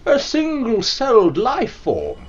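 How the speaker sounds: slow hammed-up announcement; mock-grand announcer. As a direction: declare it like a pompous narrator.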